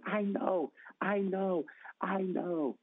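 high, eager repeated "I know"; eager excitement. Retold or imitated. Lady jumping up to answer the pastor, eager and high.

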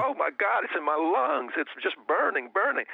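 high, excited imitation of amazement; mimicked excited outburst, high at both ends; retold astonished cry. Voices healed man's astonished cry.

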